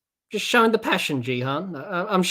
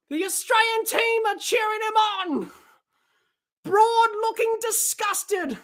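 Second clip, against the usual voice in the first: high, loud, racing cricket call; breathless live commentary, much higher; excited play-by-play; 3 dB louder than usual. Real, not acted. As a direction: call the boundary like the crowd's on its feet. Live call of the winning runs.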